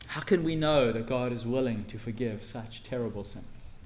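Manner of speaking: usual speaking voice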